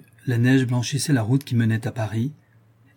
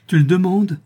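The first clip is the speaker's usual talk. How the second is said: higher, louder anguished cry; passionate cry; 7 semitones higher, 6 dB louder than usual. A narrator's reading. Gabrielle to her husband, a higher louder anguished cry. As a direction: let it burst out of her. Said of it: "s'écria Gabrielle".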